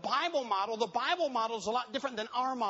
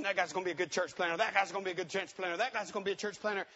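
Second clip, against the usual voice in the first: quick, repetitive chatter; fast imitation of eager onlookers; rapid mock gushing; 2 semitones lower, faster than usual. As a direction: rattle off each repeat, mimicking hasty admirers. Mimics people hastily picking planners.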